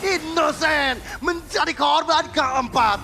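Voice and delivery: slow drawn-out shouted call; fighting game announcer. Real, not acted. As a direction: stretch each word in a big yell. Caster calls fourth kill.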